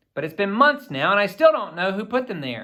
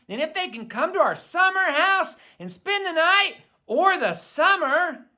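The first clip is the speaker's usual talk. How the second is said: put-on higher, exasperated teenage rant; annoyed teen sister; 11 semitones higher than usual. A narrator's reading. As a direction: pile up the complaints, fed up. Sister Emily complains at length.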